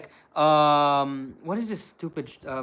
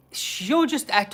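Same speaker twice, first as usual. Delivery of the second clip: ordinary delivery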